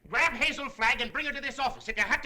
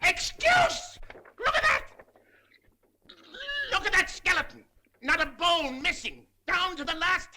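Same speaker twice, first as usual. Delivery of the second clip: furious outburst; 5 semitones higher than usual. Acted. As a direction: explode at him in fury. Editor exploding at reporter.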